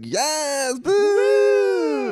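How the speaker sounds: high, loud cheering whoop; high, loud mock celebration. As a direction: cheer like at a party. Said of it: "we were like".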